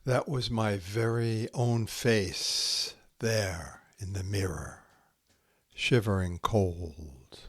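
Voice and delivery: slow, hushed haiku recital with long pauses; poem reading. Acted. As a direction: let each haiku settle into silence. Reads Santoka haiku aloud.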